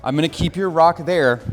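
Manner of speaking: ordinary talk, nothing marked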